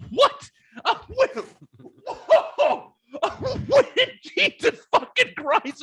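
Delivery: squeaky high mock shock, rising to a slow cry of "Jesus fucking Christ"; stunned disbelief. Telling friends how he reacted to the trooper, high-pitched mock shock. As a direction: act out your own high-pitched stunned reaction, cranked way up.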